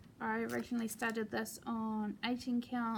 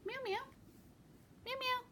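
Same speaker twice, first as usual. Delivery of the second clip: much higher meow call to the cat; 5 dB quieter than usual. Real, not acted. Calling her cat over, a high-pitched meow meow.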